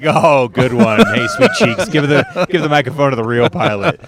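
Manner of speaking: loud mocking passenger heckling the pilot; sexist plane passenger. Greg Giraldo's passenger mocks a woman pilot, loud and jeering. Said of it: "He's like".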